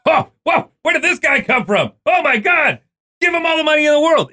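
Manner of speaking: very high, giddy astonished voice; hammed-up squealing disbelief; mock amazement. Mimicking amazed team reaction to goalie.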